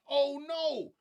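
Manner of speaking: a high, loud mock scream of oh no. Mocking the rap lyric's scream in a high loud mock scream. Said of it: "is screaming".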